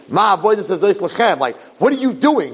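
retold indignant protest; angry kid. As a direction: imitate a kid's angry questioning. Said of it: "he, he's angry".

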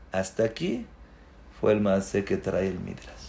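usual speaking voice

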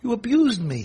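furious self-righteous rider; outraged accusation. Acted. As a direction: hurl the blame back. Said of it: "He says".

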